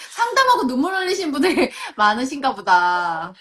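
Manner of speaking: amused, giggly and loud; laughing while talking. Real, not acted. Host reacting to comments about tearful counselling, laughing.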